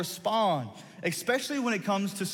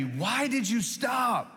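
high, slow, drawn-out yell across the mud; retold yell; 7 semitones higher, much slower than usual. Buddy shouting across the mud to a stuck friend, drawn-out yell. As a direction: call out from the far shore in disbelief. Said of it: "yelling at me".

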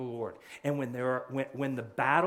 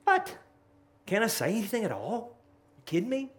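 incredulous David; 7 semitones higher than usual. Retold or imitated. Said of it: "David just kinda looks at him and goes".

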